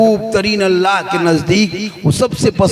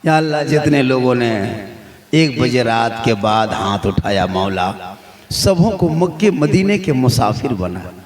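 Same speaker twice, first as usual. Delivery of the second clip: much lower, hushed supplication; prayer; 11 semitones lower than usual. Real, not acted. Leads a prayer. (गहरी, धीमी दुआ)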